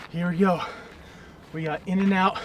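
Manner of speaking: usual speaking voice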